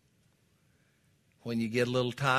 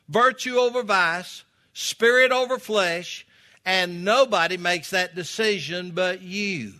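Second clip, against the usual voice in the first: loud, high preacher's exhortation; full-force preaching; 8 semitones higher, 8 dB louder than usual. Acted. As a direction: drive each point home to the congregation.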